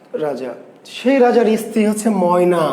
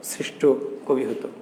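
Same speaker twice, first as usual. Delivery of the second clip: hushed for emphasis; 6 semitones lower, 9 dB quieter than usual. Real, not acted. Teacher stressing Nazrul's greatness.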